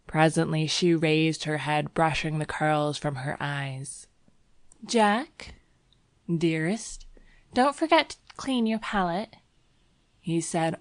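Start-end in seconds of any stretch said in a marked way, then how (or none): 4.9-9.4 higher, coaxing woman's voice; young woman character. A narrator's reading. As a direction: voice the girl sweetly calling him. Narrator voices girl to lover.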